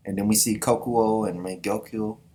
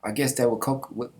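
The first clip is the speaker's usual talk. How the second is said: normal talking voice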